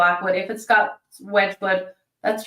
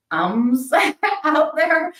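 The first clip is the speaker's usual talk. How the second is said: laughing; 6 semitones higher, much slower than usual. Real, not acted. Laughs at her own filler words.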